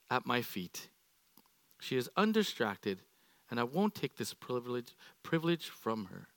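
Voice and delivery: soft, tender rebuke to a beloved friend; gentle voice of Jesus. Acted. Jesus gently answering troubled Martha, soft and tender. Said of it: "the Lord answers her".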